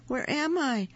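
frightened critter calling out, climbing pitch; panicky little voice. Acted. Raccoon trapped, panicking.

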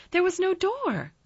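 put-on high, loud boy's protest; Colin crying out. A narrator's reading. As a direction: cry out, pitched right up. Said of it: "cried Colin".

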